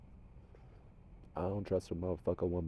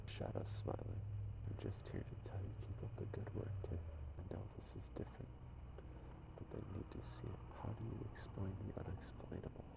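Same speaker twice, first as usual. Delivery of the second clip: soft, unvoiced whisper passing on a message from spirits; whispered channeling; 9 dB quieter than usual. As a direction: whisper it as if the spirits speak through you. Relays messages from the shadows.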